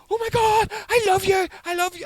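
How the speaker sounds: high, loud mock squeal of adoration; hysterical fangirls. Wee girls greeting a fake [name], hysterical fangirls. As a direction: gush like screaming young fans, very high. Mimicking young girls' fan screaming.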